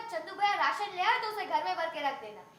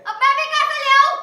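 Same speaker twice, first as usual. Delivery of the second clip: loud, raised-pitch angry question; exasperated outburst; 5 semitones higher, 11 dB louder than usual. Acted. Sister-in-law asked to find money, exasperated outburst. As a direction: burst out at yet another demand.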